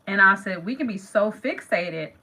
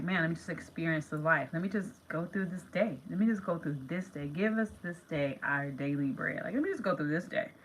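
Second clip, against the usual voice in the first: soft inner voice; 4 semitones lower, 9 dB quieter than usual. Retold or imitated. Quoted calm inner self-talk.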